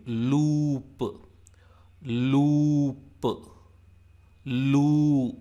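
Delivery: "loop" with a long held oo, then released p; demonstrated long vowel. Shown as a demonstration. Teacher shows lu as in loop, stretched "loooop". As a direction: draw "loop" out as luuu-p. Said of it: "it is pronounced like L O O in".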